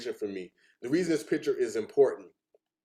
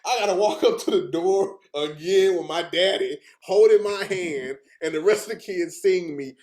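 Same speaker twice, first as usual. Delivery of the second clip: mortified high schooler; 7 semitones higher, 7 dB louder than usual. Retold or imitated. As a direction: play an embarrassed teen protesting loudly. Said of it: "when I was in high school".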